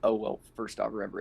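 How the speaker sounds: ordinary talk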